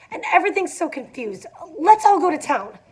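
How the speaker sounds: plead, voice climbing toward tears; near tears, pleading. A narrator's reading. Daisy to the group, near tears and pleading. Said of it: "on the verge of tears".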